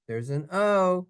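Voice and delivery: usual speaking voice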